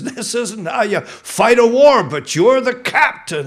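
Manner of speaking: loud, slow and high, a soldier objecting; loud protest. Acted. Joshua protesting to the captain, loud and slow. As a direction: push back hard, stretching each word.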